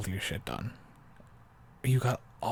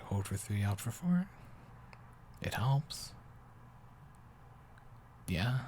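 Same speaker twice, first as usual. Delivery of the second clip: ordinary talk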